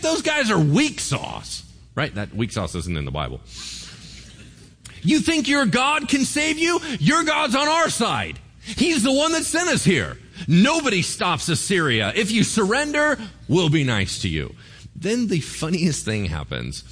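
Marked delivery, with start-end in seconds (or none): 5.0-14.5 an Assyrian commander taunting the city from below; bully yelling. Retold or imitated. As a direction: shout up at them like a smug bully. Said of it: "he starts yelling at 'em".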